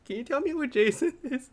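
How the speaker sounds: high-pitched imitation of an angry mom; mock maternal interrogation at the door; mom demanding her son. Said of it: "my mom straight up drove to the house".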